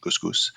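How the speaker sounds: Goose Goose said softly with two long "oo" vowels; band name pronunciation. Shown as a demonstration. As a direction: repeat Goose Goose quietly, as a name being taught. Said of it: "That's how you say it".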